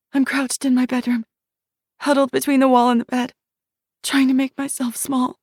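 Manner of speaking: put-on whisper-fast fear of a child hiding; terrified child. Shiloh as a child hiding from the monster, terrified high voice.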